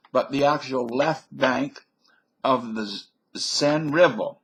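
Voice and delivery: usual speaking voice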